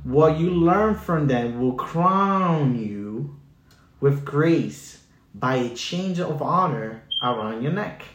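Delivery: slow, weighty verse reading; preacher reading Proverbs slowly; scripture reading. Acted. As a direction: read the verse with solemn weight.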